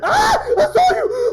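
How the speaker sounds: high, loud scream; freaking out. Real, not acted. Player seeing the monster, freaking out scream. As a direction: shriek in shock at being spotted. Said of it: "I'm freaking out".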